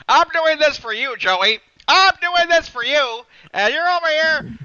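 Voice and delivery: high, loud, slow angry imitation of Jason; Jason impression. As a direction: rant it like Jason at top volume. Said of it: "You sound like Jason now".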